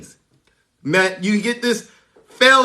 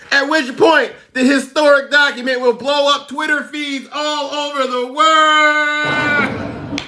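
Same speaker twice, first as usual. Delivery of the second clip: excited shout; 6 semitones higher, 3 dB louder than usual. Real, not acted. Streamer to his chat, shouting with glee. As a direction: yell the news gleefully. Hyped announcement of the release.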